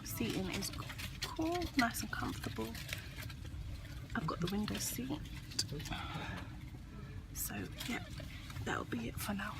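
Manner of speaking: hushed whisper on a quiet train. Real, not acted. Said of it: "Don't even know why I'm whispering".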